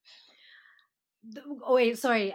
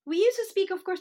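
normal talking voice

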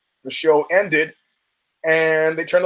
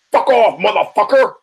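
loud, raised-pitch imitated outburst; angry shout; 6 semitones higher, 5 dB louder than usual. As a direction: bellow it as an aggressive brush-off. Danzig pushes Danny, retold.

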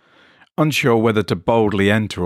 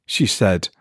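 plain reading voice

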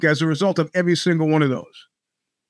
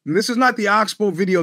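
ordinary delivery

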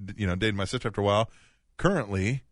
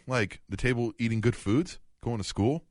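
ordinary talk, nothing marked

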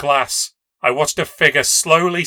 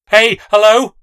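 high, loud call up the stairs; 9 semitones higher, 12 dB louder than usual. A narrator's reading. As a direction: shout for whoever came in. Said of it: "stumbled to the stairs and shouted".

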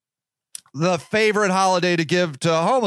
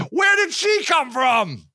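astonished; 5 semitones higher than usual. Retold or imitated. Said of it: "and I'm like".

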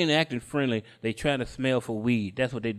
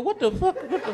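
very high disbelieving squeal; acted high-pitched outrage; incredulous outburst; 12 semitones higher than usual. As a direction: exclaim it high, utterly baffled. Outraged at the ticket.

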